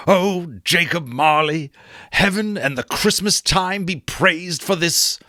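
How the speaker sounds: joyful Scrooge. Acted. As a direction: praise heaven, slowing at the end. Scrooge voiced in story reading.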